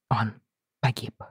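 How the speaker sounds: soft, hushed grief; quiet. A narrator's reading. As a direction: say it barely aloud. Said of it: "тихо произнёс лорд Джон". (тихо, с горечью)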